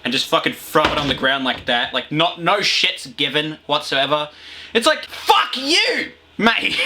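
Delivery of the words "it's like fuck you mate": much higher, slow, hammed-up bloke; mock yobbo shout. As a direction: sneer it like a rude litterbug. Mocking litterbug attitude.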